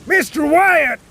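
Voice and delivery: high, sharp call; captain calls to Cornelius on the sinking ship, shouting. Acted. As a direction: call his name across the deck. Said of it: "shouted the captain".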